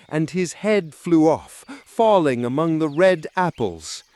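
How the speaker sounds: ordinary delivery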